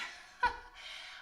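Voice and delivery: breathy little laugh; quick airy chuckle; short laugh. Real, not acted. Dog appears behind her.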